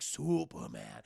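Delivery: slow, low robot voice; giant robot. Retold or imitated. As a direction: say it low and drawn out like a metal giant. Giant's heroic self-naming.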